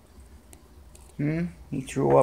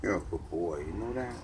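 1 soft cooing; 9 semitones lower, 11 dB quieter than usual. Real, not acted. Cooing softly to cat.

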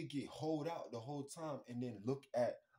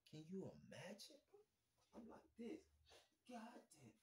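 unvoiced, much softer; whispered aside; 6 semitones higher, 16 dB quieter than usual. Real, not acted. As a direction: breathe it out under your breath.

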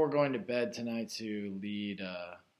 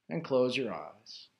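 guided meditation; 3 semitones higher, 5 dB louder than usual. Real, not acted. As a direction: guide listeners inward, unhurried, phrase by phrase. Leads a guided meditation after chat.

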